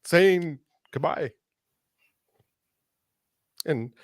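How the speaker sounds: holding back tears, halting with long pauses; fighting to get the words out through emotion; choked up. Real, not acted. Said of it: "I'm getting emotional on it".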